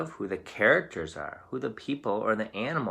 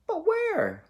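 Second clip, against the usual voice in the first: much higher, louder worried goat voice; fretful goat voice; nervous middle goat; 4 dB louder than usual. Acted. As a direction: squeak it out like a nervous little goat. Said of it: "asked the middle brother".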